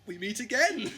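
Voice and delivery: high, loud mock-menacing villain greeting; a gloating villain voice, pitched well up; hammy villain line. Cyber leader, composed again, greets the Doctor as a hammy villain. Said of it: "compose himself and go".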